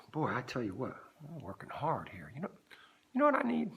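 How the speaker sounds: usual speaking voice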